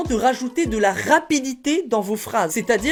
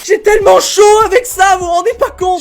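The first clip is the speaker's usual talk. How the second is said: high, loud whine; exasperated complaint; 9 semitones higher, 10 dB louder than usual. Acted. Creator complaining about the hot costume, loud high exasperation. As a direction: wail it in exasperation.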